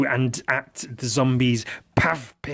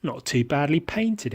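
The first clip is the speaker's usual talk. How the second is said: ordinary talk, nothing marked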